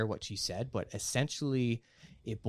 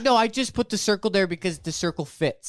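mock defensive; 4 semitones higher, 7 dB louder than usual. Acting out a smug defensive voice.

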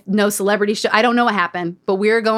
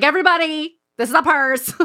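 mock proud announcement; 5 semitones higher than usual. Showing off the purse.

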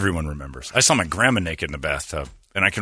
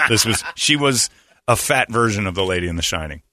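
ordinary talk

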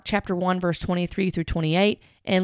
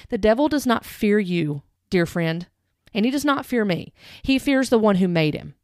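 ordinary talk, nothing marked

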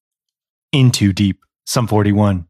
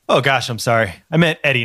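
normal talking voice

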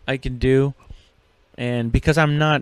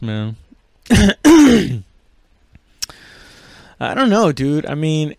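ordinary delivery

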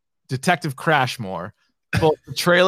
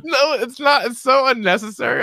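mock protest; 9 semitones higher than usual. Acts out protest from the sketch.

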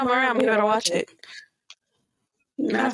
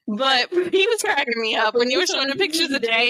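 ordinary delivery

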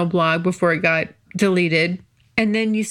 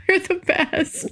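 laughing; 10 semitones higher than usual. Real, not acted. Laughing after thanking listeners.

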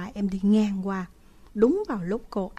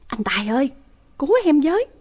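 desperate loud cry for help; calling for help; 6 semitones higher, 8 dB louder, much faster than usual. Retold or imitated. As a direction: scream out to your brother. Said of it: "nên em kêu to lên". (tiếng kêu cứu thất thanh)

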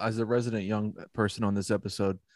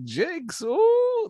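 excited squeal. Retold or imitated. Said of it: "he's like".

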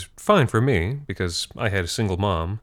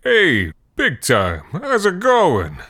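put-on booming friendly old man hello; hearty shout; 9 semitones higher, much slower than usual. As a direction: call out to a kid down the street. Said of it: "he'd shout something like".

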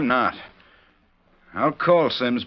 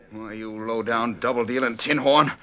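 angry accusation. Acted. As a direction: spit the insult, then erupt. Cowboy accuses gambler of cheating.

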